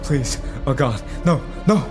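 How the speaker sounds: high, frantic begging; panicked pleading. Acted. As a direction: beg in terror as you are caught. Driver caught by his pursuer, pleading.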